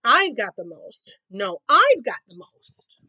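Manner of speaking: loud, slow I've got the most; squabbling cubs. Acted. Cubs bragging over jelly beans, loud shout. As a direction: bellow it to win.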